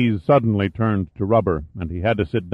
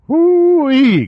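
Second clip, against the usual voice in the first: high, loud country whoop; astonished gasp; 8 dB louder than usual. A narrator's reading. Local veteran learns shells hold gas, astonished high whoop. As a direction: let out a shrieking hoot of shock. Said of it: "gasped Lovell".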